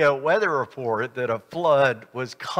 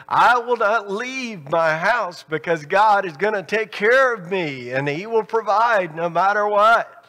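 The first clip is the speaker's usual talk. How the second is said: higher, firm insistence on every word, "I will not leave"; emphatic refusal; 5 semitones higher than usual. Retold or imitated. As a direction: insist he won't leave, leaning on each word. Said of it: "and he says emphatically".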